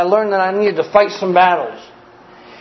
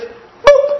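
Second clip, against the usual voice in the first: ordinary delivery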